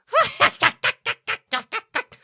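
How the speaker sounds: high, loud staccato bird cackle. Acted. Blackbird laughing in the player's ear, high cackle. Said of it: "It laughs in your ear".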